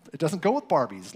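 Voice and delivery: usual speaking voice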